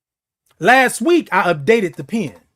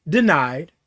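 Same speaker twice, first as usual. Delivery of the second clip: system denial; 5 semitones lower than usual. Pretend. Machine refusing the code.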